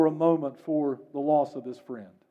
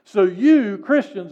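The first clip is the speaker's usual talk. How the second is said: ordinary delivery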